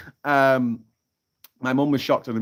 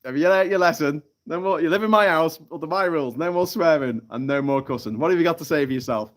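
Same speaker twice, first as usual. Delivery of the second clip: raised, loud telling-off; sergeant major; 5 semitones higher, 5 dB louder than usual. Acted. Sergeant major lecturing the frozen parrot, loud stern voice. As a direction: lay down the law firmly. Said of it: "And he goes".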